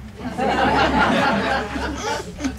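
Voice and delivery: real laughter at the punchline; loud laugh. Real, not acted. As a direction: laugh at your own joke. Laughing at own joke.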